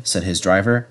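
ordinary delivery

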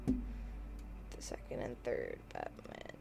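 very soft, slow whisper; whispered read to oneself; reading under breath. Real, not acted. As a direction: murmur it slowly to yourself while reading.

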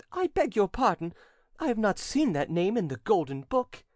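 high-pitched, affected puzzlement; querying young captain. A narrator's reading. Captain queries an unknown name.

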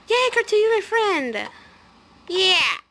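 high, loud happy squeal; cheering a new friend; excited cheer. Real, not acted. Kid gamer cheering as a friend accepts her request, excited.

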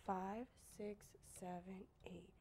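very soft, near-whispered counting; hushed count-in. Real, not acted. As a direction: count the class in under your breath. Quiet count-in before clapping.

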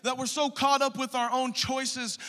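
loud, high preaching at full force; fiery preaching. Acted. Pastor urging the congregation, loud fiery preaching. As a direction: preach it hard to the church.

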